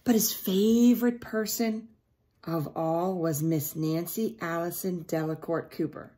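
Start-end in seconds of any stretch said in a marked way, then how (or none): none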